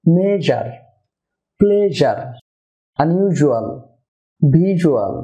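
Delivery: buzzing zh in words like মেজার, প্লেজার, ইউজুয়াল; word-list demo with zh, as in ক্লোজার, each falling at the end; mid-tongue zh. Shown as a demonstration. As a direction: say the জ in ট্রেজার as zh, first syllable stretched. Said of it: "যা উচ্চারণ হবে".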